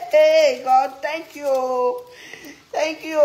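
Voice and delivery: loud laughing burst. Real, not acted. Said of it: "laughing at proper grammar".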